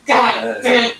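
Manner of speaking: a hint of a father reacting to twins news; dad's stunned swearing. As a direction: mutter it like a shocked father. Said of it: "My daddy was like".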